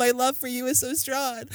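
high-pitched mock swoon; gushing fan. Fan confessing strong love to the idol, high swooning voice. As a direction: declare love like a smitten fan.

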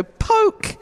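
high, sharp jab word; playful poke cry. Pretend. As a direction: yelp it out as you stab. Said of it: "just go".

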